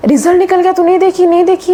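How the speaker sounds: high, urgent friend on the phone; panicked friend. Retold or imitated. Friend calling her about results, panicky high voice.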